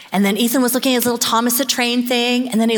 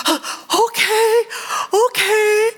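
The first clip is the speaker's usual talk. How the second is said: put-on sobbing toddler; 11 semitones higher, much slower than usual. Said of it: "he's like".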